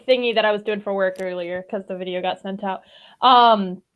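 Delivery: ordinary delivery, nothing marked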